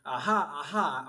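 mildly scornful, drawn-out jeer; contemptuous aha. Acted. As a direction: gloat with two slow ahas. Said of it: "an evil and contemptuous expression".

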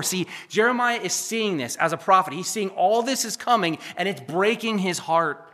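ordinary talk, nothing marked

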